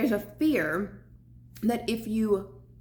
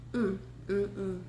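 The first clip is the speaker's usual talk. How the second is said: appreciative mm while chewing; 4 dB quieter than usual. Real, not acted. Tasting food in mukbang.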